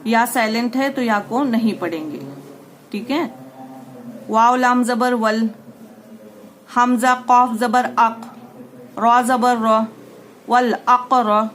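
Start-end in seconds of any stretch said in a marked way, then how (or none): 4.3-11.5 louder, slow spelling-out recitation; syllable drill. Shown as a demonstration. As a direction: sound out each part, then join it.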